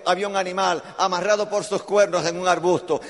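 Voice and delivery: usual speaking voice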